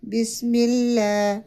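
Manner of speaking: اللہ said light and thin, not heavy; slow بسم اللہ with a thin اللہ; thin Allah. Shown as a demonstration. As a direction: read اللہ with a fine, light lam. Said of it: "اللہ کے نام کو باریک پڑھیں گے".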